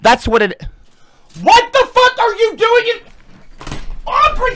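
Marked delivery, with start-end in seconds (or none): 1.4-4.5 slow, high, loud furious yell; furious shout. Real, not acted. Yelling at Aubrey over his painting, furious shout. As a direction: roar it out in real outrage.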